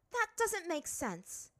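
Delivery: high, sharp princess voice; a proud girl brushing it off; haughty princess. A narrator's reading. Azula skeptical.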